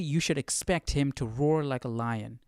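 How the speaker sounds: ordinary delivery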